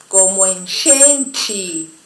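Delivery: usual speaking voice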